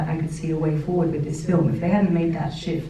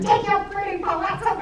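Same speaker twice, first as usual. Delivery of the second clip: much higher, hammed-up tantrum voice; mock meltdown. Ken hurling Barbie's clothes off the dream house, mock tantrum in a much higher voice. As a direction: play a costar's over-the-top fit, pitched way up. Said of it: "massive meltdowns".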